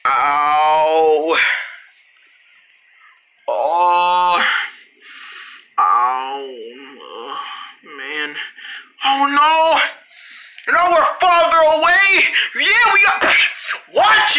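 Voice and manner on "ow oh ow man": pained groan. Acted. Landing after the fall.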